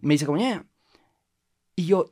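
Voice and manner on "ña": a light mock of a stranger's single syllable; caricatured Thai word. Narrator lightly caricaturing the Thai woman's word. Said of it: "Lo estoy caricaturando".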